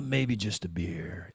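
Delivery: soft, slow tempted murmur; a half-guilty small voice; tempted aside. Retold or imitated. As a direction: sneak it in quietly. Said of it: "rolls in".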